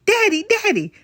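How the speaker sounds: high, eager "Daddy, Daddy"; excited calling. Acted. Little girl calls excitedly to her dad on video chat. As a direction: call out to dad on screen.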